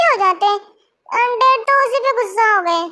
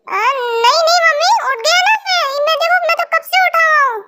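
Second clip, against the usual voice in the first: high, hurried scared pleading; panicked excuse; 5 semitones higher than usual. Acted. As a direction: squeak out a guilty excuse. Son panics when mother enters.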